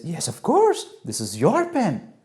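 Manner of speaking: high, swooping yes then slow confirmation; pleased motherly affirmation; approving mother. Acted. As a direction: reassure the child with drawn-out approval. Mother approves child's corrected answer.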